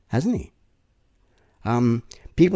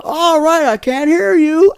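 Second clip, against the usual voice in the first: high, loud emcee demanding more noise; cheesy emcee shouting at the crowd; hype MC shouting; 10 dB louder, slower than usual. Retold or imitated. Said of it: "So they'll say something like".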